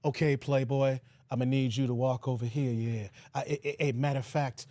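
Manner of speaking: deep, low mock [name] directing; [name] impression. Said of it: "Puff is directing this".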